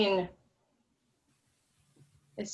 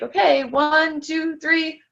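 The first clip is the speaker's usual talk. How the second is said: swim instructor counting; 9 semitones higher, 6 dB louder, much slower than usual. Retold or imitated. Retold instructor counting the child down.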